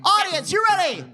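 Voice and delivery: loud high hype-man call; hype shout. Real, not acted. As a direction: pump up the crowd. Hyping up the table read.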